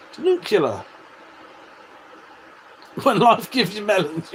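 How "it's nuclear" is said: "nuclear" pronounced carefully as noo-klee-er. Shown as a demonstration. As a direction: spell out nuclear syllable by syllable. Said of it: "It's not nuclear".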